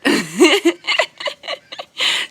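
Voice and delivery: loud, high burst of laughter; big giggle. Real, not acted. Speaker amused by her own boom, high loud laugh.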